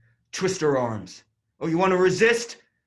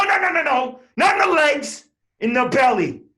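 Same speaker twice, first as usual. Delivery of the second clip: higher and louder barked commands; shouted orders; 10 semitones higher, 5 dB louder than usual. Acted. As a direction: yell orders across the street.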